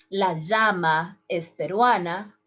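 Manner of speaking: llama with a zh sound, "zhama"; llama voiced like the s in measure; second way. Shown as a demonstration. Teacher demos llama with zh. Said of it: "in the three different ways".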